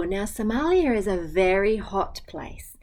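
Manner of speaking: ordinary delivery, nothing marked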